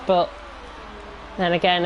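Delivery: ordinary delivery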